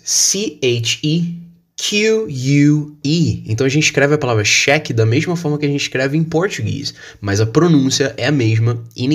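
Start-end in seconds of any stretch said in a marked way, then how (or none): 0.0-3.4 slow letter-by-letter spelling; slow classroom spelling of each letter. Shown as a demonstration. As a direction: spell C O L O R slowly, one letter at a time. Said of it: "In the British English".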